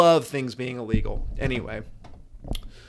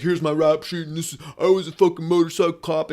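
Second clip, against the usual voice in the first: imitating the cop; 2 semitones higher than usual. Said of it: "he did the whole fucking cop".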